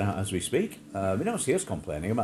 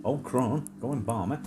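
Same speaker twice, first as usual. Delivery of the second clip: ordinary talk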